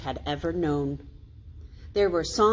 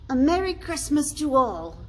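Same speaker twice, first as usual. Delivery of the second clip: higher, louder cheerful toast; bright toast, pitched up; Bob's toast; 9 semitones higher, 5 dB louder than usual. A narrator's reading. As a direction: raise a glass and cheer it. Bob toasts Scrooge.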